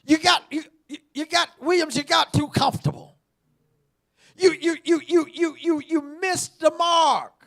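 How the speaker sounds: loud, high, chanted preaching; sing-song the rebuke; preacher calling out Williams; full-force preaching. Acted.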